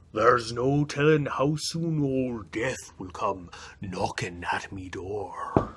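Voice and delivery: low, slow mock-doom; ominous lament. Jamie fearing Death's knock, low slow mock dread. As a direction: darken it like death is coming. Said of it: "wailed Jamie".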